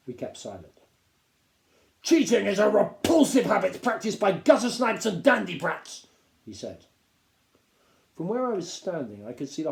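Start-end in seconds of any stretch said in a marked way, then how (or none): 2.0-6.1 high, loud contempt; captain denouncing cheats; scornful lecture. A narrator's reading. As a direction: sneer at the whole class.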